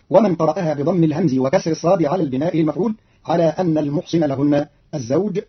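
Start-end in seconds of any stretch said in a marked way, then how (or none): none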